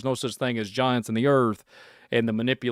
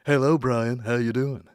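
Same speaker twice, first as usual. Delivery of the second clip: AI voice. Pretend. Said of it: "conversation and podcast with A I".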